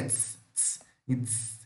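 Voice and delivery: soft low hissed "ts"; voiceless ts, slow and quiet; ts sound. Shown as a demonstration. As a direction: ts hiss. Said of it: "É diferente desses aqui".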